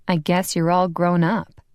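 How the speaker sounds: usual speaking voice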